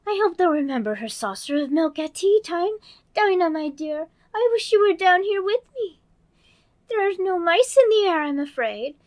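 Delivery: put-on high, bright little girl voice; Alice voice. Narrator voicing Alice, girlish and eager. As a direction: play young Alice talking to herself.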